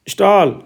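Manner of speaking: "स्टॉल" with a long open "staal" vowel; teacher showing the आ sound in "स्टॉल"; vowel as Hindi आ. Shown as a demonstration. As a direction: model "स्टॉल" clearly, falling at the end. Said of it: "ये आ की ध्वनि में उच्चारण है".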